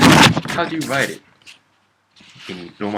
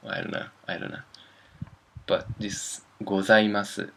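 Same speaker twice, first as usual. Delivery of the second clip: ordinary delivery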